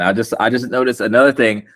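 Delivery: normal talking voice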